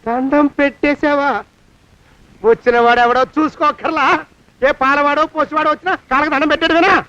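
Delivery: high-pitched, incredulous telling-off; indignant scolding. Acted. Man scolds girl for greeting stranger.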